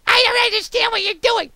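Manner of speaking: put-on very high, loud, baffled girly voice; his other girl voice, high and exasperated; second girl voice. Shown as a demonstration. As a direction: squeak it out like a confused cartoon girl. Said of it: "And then there's".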